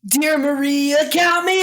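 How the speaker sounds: mock character read. Reads in a performed voice.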